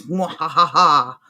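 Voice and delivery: slow low mad scientist cackle; villainous mwahaha, drawn out; evil laugh. Pretend. Evil laugh after world domination joke.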